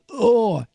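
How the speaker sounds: high-pitched mock "oh" of pain; pained cry. Artie as the troop leader rubs him, mock pained cry. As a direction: yelp it as if pressed on a wound.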